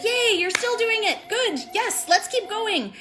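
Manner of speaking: put-on high, bubbly cheer at old friends; excited cheer. Greeting old colleagues at a training, excitedly cheering. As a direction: cheer them on, thrilled to see them. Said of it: "because I was like".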